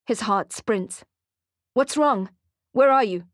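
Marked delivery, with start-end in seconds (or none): 1.7-3.2 high, loud, urgent questions; panicked. Acted. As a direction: demand an answer in alarm. Panicked on phone.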